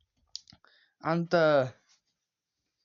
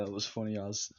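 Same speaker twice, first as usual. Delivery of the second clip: ordinary talk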